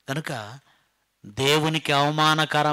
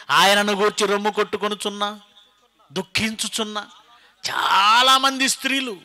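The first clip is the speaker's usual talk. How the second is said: usual speaking voice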